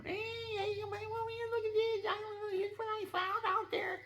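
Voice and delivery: very high whiny cat voice; cat presenting a snake to its owner, in a very high whining voice. Retold or imitated. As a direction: whine it like a proud cat showing off its catch. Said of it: "Then they start whining".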